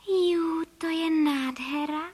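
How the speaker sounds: high, drawn-out cry of delight; delighted exclamation. Acted. Mother bird trying out the finished nest, delighted exclamation. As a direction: marvel slowly in a high, delighted voice. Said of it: "vykřikla paní Frejková".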